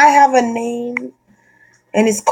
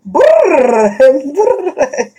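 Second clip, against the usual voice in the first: mock trill; 6 semitones higher, 3 dB louder than usual. Imitating spiritual work sound.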